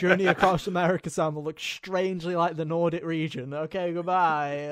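a loud, hammed-up sales pitch; mock announcer. Voicing the game's pitch.

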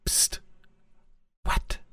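hushed whisper; breathy little whisper in the dark; leaning in to whisper. Retold or imitated. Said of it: "the little girl asleep and it's just".

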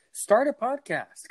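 high, slow push on start a podcast; eager pitch to listeners; excited urging. Real, not acted. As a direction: sell the idea with a sudden high lift.

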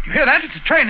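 high, urgent alarm as the train approaches. Acted. Watson hearing a train bear down, alarmed.

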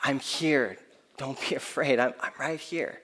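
gentle reassuring parent; soothing a scared child. Retold or imitated. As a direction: hush a child back to sleep after a nightmare. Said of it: "what we say to our kids when they wake up from a nightmare".

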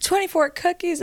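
high-pitched disbelief at the number; shocked disbelief. Retold or imitated. Mom repeating the dad's news in high disbelief. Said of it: "I'm like".

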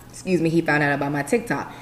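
normal talking voice

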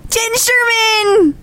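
high, slow announcing shout; excited announcement. Real, not acted. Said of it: "Who is on the show today?".

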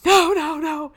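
high, loud panicked refusal. Retold or imitated. Driver refuses to back down the mountain, panicked. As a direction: blurt it in mock panic. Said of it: "She panicked".

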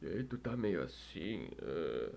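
low, slow, soft just-woken croak; hoarse morning voice. Shown as a demonstration. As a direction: demonstrate a groggy, hoarse waking voice. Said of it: "eu tava com a voz meio rouca".

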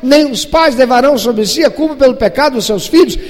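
loud, high, fervent pulpit delivery; loud sermon voice, ringing high; full-force preaching. Acted. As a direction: preach it out to the congregation.

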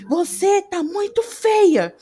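high, loud, drawn-out exclamation; exaggerated exclamation. Shown as a demonstration. Showing how intonation carries meaning to hearing people, exclaiming. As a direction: gush it out slowly with a big swoop. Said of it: "entendem o que é dito pela entonação da voz".

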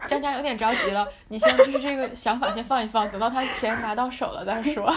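teasing through laughter; laughing. Real, not acted. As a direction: laugh while you tease. Teasing friend while laughing.

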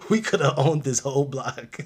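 loud, high wail through laughter; hammed-up indignation, breaking into laughs; laughing mock complaint. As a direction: protest it while cracking up. Ancestor bit continues through laughter.

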